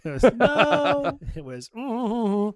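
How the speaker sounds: laughing it off, high and slow; real laughter while refusing to say. Real, not acted. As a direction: giggle through a coy dodge. Laughing off the hospital question.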